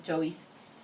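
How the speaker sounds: ordinary delivery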